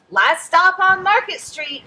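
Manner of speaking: loud, high call down the bus. A narrator's reading. Bus driver calls the last stop to passengers, loud and high. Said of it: "Mister Dennis called".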